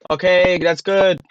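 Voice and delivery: loud, slow pleased praise; slow, loud mock pat on the back; mock teacher praise. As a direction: praise yourself like a proud teacher.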